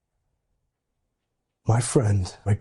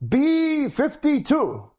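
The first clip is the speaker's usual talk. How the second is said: high, drawn-out bingo call; slow, high, stretched-out bingo caller; 13 semitones higher, much slower than usual. Retold or imitated. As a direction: sing out the number like a bingo caller. Bingo caller announcing numbers.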